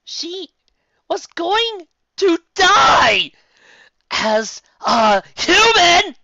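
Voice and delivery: high, loud, slow snarled shout. Acted. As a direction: spit it out with rage. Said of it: "Lyra snarled".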